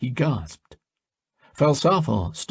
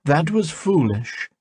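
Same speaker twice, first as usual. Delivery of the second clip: scolding; 8 semitones higher than usual. A narrator's reading. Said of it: "scolded Harmon".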